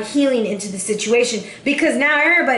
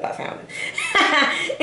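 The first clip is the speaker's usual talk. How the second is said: laughing; 6 semitones higher, 5 dB quieter, much slower than usual. Real, not acted. Laughing at her own story.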